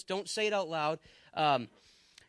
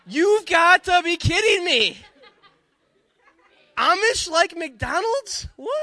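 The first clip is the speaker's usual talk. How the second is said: slow, much higher and louder, laughing; laughing disbelief; 12 semitones higher, 12 dB louder, slower than usual. Real, not acted. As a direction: stretch it out, cracking up in disbelief.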